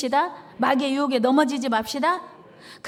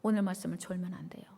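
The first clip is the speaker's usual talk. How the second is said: hushed, unvoiced warning; breathy whispered aside; 6 semitones lower, 9 dB quieter than usual. Real, not acted. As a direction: whisper it to the sleepy ones. Warning dozing listeners.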